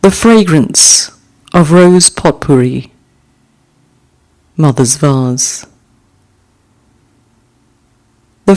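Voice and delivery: slow, measured haiku reading with pauses; gentle recital of a short poem. Acted. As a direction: let each image settle before the next. Reads haiku aloud twice.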